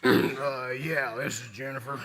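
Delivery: slow, gravelly just-woken answer; groggy, rough phone voice; gravelly morning voice. Acted. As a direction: croak it slowly, still half asleep. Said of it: "in my gravelly voice at three in the morning".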